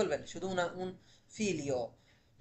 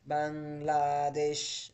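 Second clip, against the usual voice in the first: Bangladesh stretched very slowly, syllable by syllable; slow teacher emphasis on Bangladesh; drawn out; 2 semitones lower, much slower than usual. Shown as a demonstration. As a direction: hold out Bangladesh so students catch it. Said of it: "teacher repeats the answer word".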